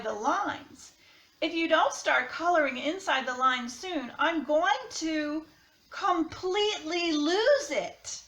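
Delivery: expressive picture-book read-aloud, crayon letters lightly voiced; reading to children. A narrator's reading. Teacher reading a crayon picture book to her class, lively storytime voice.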